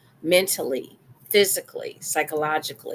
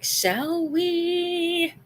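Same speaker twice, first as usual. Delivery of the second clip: 1 playful; 13 semitones higher than usual. Acted. Playful invite.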